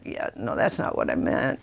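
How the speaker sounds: breathy whispered retort under her breath; whispered aside. Retold or imitated. Whispering a private retort to the friend's India suggestion. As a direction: whisper it so the friend can't hear.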